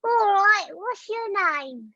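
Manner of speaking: high, drawn-out character greeting; Sticker character voice. Acted. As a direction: pitch it way up and stretch the words. Improvising Sticker meeting Julia.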